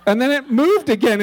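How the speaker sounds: ordinary talk, nothing marked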